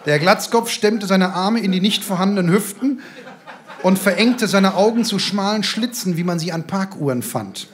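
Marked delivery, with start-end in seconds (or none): none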